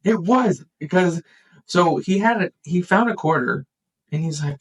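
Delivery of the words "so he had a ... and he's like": normal talking voice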